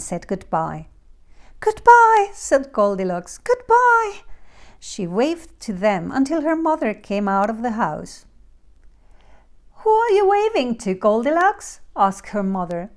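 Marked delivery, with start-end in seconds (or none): 1.6-2.3 put-on high, loud girlish goodbye; called-out farewell. A narrator's reading. Goldilocks calling farewell to the bears. 3.4-4.2 put-on high, loud girlish goodbye; called-out farewell. A narrator's reading. Goldilocks calling farewell to the bears. 9.8-11.8 high, quick, projected question; questioning mother. A narrator's reading.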